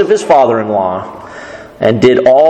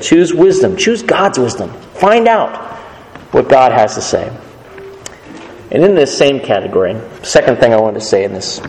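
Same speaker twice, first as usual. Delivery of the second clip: ordinary talk, nothing marked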